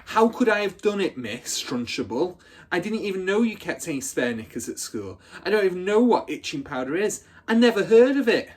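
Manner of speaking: a hammed-up plea of innocence; retold shouting. Hortensia replays her lying protest to Trunchbull, shouting. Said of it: "I kept shouting".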